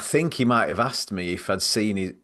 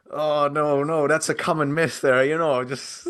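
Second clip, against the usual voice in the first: flustered; 7 semitones higher than usual. Retold or imitated. Caught out, scrambling.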